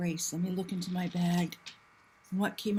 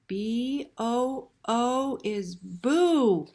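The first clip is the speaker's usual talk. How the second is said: plain reading voice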